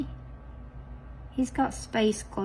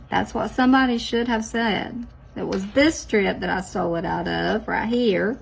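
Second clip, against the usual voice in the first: ordinary talk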